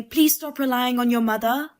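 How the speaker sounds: ordinary talk, nothing marked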